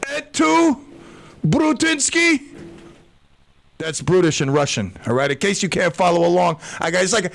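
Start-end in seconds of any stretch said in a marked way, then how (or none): 0.1-2.4 high, drawn-out mock dying betrayal; hammed-up stabbed leader, pitched well up; Putin. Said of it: "he stabs him, and he's like".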